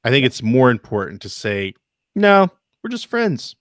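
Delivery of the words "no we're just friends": guy insisting they're just friends, mock innocent; mock innocent denial. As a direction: play it up as a too-innocent excuse. Mocking a hypothetical denial.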